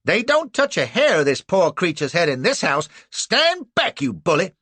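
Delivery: high, loud protective scolding; fierce old lady. A narrator's reading. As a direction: stand up to the bully. Woman defending Cassie fiercely.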